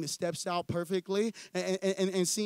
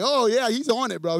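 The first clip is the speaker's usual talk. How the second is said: excited; 2 semitones higher, 6 dB louder than usual. Real, not acted. Excited reply to David's amen.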